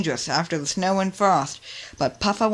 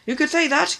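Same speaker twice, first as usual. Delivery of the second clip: gasping; 11 semitones higher, 3 dB louder than usual. A narrator's reading. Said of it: "gasped Puffer".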